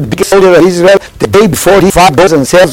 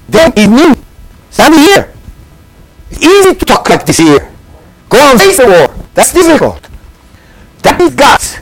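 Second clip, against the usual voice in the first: impassioned; 9 semitones higher than usual. Acted. Rising sermon climax.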